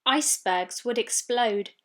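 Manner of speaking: plain reading voice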